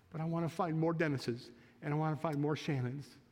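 ordinary delivery

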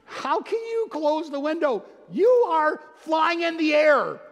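high, loud, amazed cry; a kid who can't believe you'd close the window; astonished kid. Retold or imitated. Preacher acts himself to bored passengers, amazed high cry. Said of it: "I'm like".

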